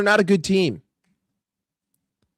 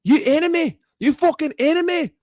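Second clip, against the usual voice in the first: loud, high, outraged Scottish football fan; irate Scottish supporter, pitched way up; mock Scottish accent; 8 semitones higher, 4 dB louder than usual. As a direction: rant in a hammed-up Scottish accent. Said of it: "All I can do is Scottish".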